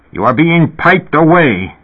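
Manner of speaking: high, slow, emphatic accusation; furious. A narrator's reading. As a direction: voice an old man's fury in a storyteller's measured way. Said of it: "Elias said furiously".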